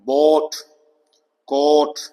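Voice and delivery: बोट, कोट with a long rounded O; long O sound. Shown as a demonstration. Teacher giving OA-word examples, long O. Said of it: "ये भी long sound है".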